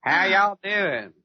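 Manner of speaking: high, drawn-out Southern greeting; mock Georgia accent. Guest copying the host's accent, slow mock Southern. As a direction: imitate a Southern drawl, slowly. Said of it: "copy your accent, Daniel".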